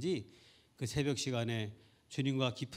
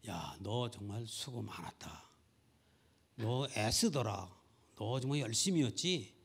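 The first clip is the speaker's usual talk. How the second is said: gentle praise; 4 semitones lower, 4 dB quieter than usual. Retold or imitated. Voices the Lord praising her.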